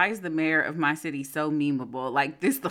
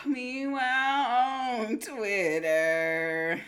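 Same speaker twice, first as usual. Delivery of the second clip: announcer voice; 6 semitones higher, much slower than usual. Pretend. Announcer intro.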